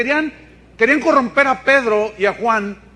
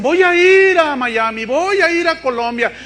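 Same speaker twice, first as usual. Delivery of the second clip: higher, louder, eager mock voice of people chasing power; mock-eager pilgrim voice, pitched up; mocking eager believers; 6 semitones higher, 4 dB louder than usual. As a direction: parody the desperate believer who'll travel anywhere. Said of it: "Hay muchos que dicen".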